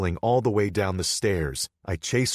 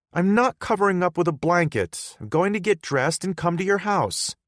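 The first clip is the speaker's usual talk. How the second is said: put-on higher, louder, upset girlfriend voice; hurt girlfriend Hannah; 12 semitones higher, 7 dB louder than usual. Hannah confronting Bodhi, higher and louder. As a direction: confront him for shutting her out.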